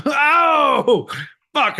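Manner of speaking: a loud laughing oh; laughing outburst. Real, not acted. Host cracking up at co-host's joke, loud laughing oh. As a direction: burst out laughing.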